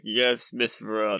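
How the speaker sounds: low, soft obedient butler line; a deadpan hint of a servant; lightly voiced butler. Co-host voicing the meek butler, low and soft.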